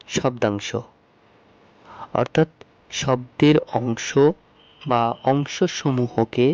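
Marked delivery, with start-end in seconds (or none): 2.9-6.5 slow explanation. Shown as a demonstration. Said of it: "teacher explains meaning slowly".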